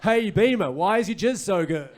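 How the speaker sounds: loud, high put-on interview question; mock interviewer call. Reporter interviewing a prize cow, loud mock-keen call. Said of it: "I'm out there being like".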